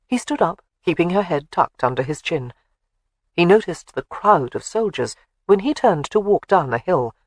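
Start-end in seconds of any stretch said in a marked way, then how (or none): none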